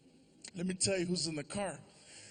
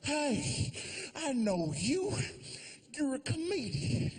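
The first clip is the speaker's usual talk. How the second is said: a cop, voiced a little higher; lightly voiced cop; 6 semitones higher, slower than usual. The cop spotting a famous comedian, lightly voiced and friendly. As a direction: recognize him, friendly. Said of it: "He's like".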